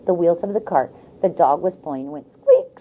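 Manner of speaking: plain reading voice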